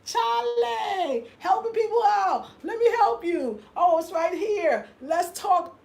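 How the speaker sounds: high-pitched, drawn-out calling out; loud talk. Retold or imitated. Retelling her loud talk to people outside, high drawn-out calling. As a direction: call out high, stretching the words like a hallelujah. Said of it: "I was loud talk".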